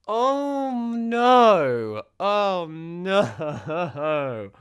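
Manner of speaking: a hammed-up groaning oh no, the second one deflated; playfully dreading the pain to come; mock despair. Vlogger dreading the sad episodes, mock despair.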